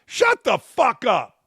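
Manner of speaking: a hammed-up yell to shut up, slowing down; barking a mock order, slowing on the last one; mock telling a pundit to shut up; mock shout.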